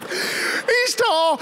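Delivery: high falsetto mock broken by laughter; teasing relatives crack up while mimicking, high voice; laughing mockery. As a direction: laugh through the mocking squeak. Relatives mocking scared kid.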